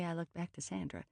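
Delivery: plain reading voice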